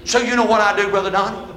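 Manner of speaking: normal talking voice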